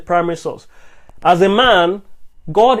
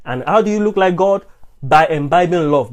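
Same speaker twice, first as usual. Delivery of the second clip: normal talking voice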